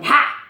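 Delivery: loud acted gasp, unraised pitch; cat's shocked "ha"; imitated cat gasp. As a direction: gasp like a startled big cat. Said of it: "as the cat going".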